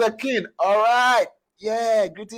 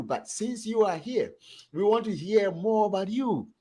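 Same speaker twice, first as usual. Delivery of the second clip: ordinary talk, nothing marked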